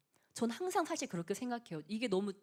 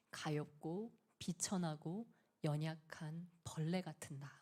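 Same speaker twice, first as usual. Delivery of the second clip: slow, hushed whisper; 3 semitones lower, 6 dB quieter, slower than usual. Shown as a demonstration. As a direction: breathe it out like a humble confession. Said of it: "근데 그는 자기를 어떻게 불렀어요?". (느리게 속삭이듯)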